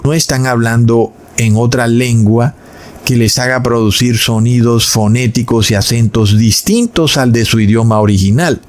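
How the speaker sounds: ordinary talk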